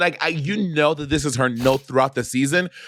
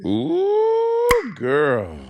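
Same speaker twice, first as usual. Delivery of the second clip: shady ooh; 12 semitones higher than usual. Real, not acted. Reacting to a shady reply.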